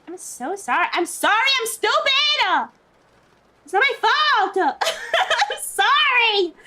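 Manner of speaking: slow, high, loud hammed-up wail of sorry; mock panicked apology. Apologizing to an annoyed Spanish speaker, a hammed-up panicked wail. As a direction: overdo the apology, half laughing. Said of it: "I'm like".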